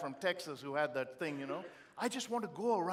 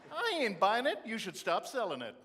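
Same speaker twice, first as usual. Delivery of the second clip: loud, high, laughing disbelief; you can't believe this nonsense, laugh it out loud; rising up in amused outrage; incredulous laughing rant; 6 semitones higher, 6 dB louder than usual. Real, not acted.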